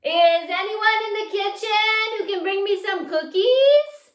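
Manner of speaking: loud, high sing-song call through the walls. Shown as a demonstration. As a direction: call to the kitchen for cookies. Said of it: "yell through the walls into the kitchen".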